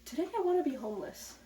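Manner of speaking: high, loud and fast mock cheer; mock chipper. Someone waking up chirpily choosing homelessness, in hammed-up cheer. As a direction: sing it out like a chirpy idiot. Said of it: "Nobody wakes up one day and goes".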